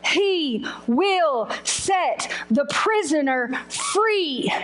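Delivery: slow, word-by-word verse; measured, spaced-out reading aloud; solemn scripture reading. Retold or imitated. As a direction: let every word of the promise land. Reads the Bible verse aloud.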